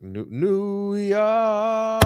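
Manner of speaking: New, New York stretched very slowly; drawn out. Real, not acted. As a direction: drag the name out in disbelief. Dragging out the team name.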